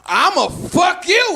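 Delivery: put-on a scared kid trying to sound hard; mock tough threat. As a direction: deliver a threat that comes out wrong. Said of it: "And so I was like".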